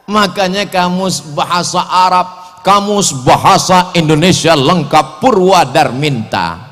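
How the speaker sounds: ordinary delivery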